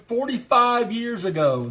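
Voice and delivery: ordinary talk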